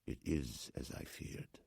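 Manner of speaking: slow, near-whispered dismay; bitter disappointment. A narrator's reading. Old man learning the coffin is gone, bitterly disappointed. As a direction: let it out slowly, almost voiceless. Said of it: "Satrakian swallowed with bitter disappointment".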